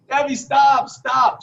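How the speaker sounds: calling after Debbie to stop the joke, a retold shout; yelling to stop her. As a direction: call her back before she phones. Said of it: "I'm yelling".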